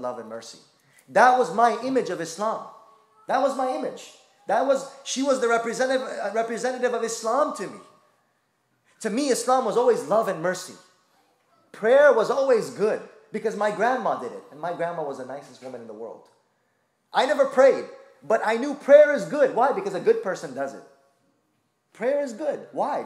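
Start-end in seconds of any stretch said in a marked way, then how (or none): 1.1-14.4 high, loud impassioned sermon; full-force preaching. Acted. Preacher to congregation about Islam as mercy, impassioned and loud. 17.1-23.0 high, loud impassioned sermon; full-force preaching. Acted. Preacher to congregation about Islam as mercy, impassioned and loud.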